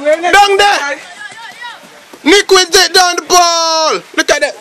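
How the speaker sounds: high, energetic commentary; worked-up amateur commentator; excited play-by-play. Real, not acted. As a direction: call the ball's path with urgency. Calling live ball action.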